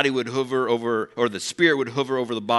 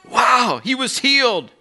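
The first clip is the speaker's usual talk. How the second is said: much higher, thrilled wonder; amazed wow; 12 semitones higher, 5 dB louder, slower than usual. Shown as a demonstration. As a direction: gasp it out in amazement. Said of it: "is amazed".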